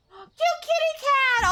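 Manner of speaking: high, slow gushing squeal; squealing delight. Real, not acted. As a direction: coo over the kitty. Gasps at cute item.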